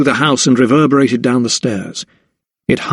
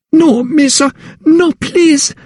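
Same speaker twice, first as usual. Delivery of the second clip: much higher and louder, desperate plea; terrified pleading; 3 dB louder, slower than usual. A narrator's reading. Slave girl begging her mistress, terrified. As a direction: beg for mercy in terror. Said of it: "she pleaded".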